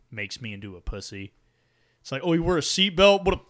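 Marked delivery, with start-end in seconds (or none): 2.2-3.4 mocking taunt. Voicing a mocker of safety.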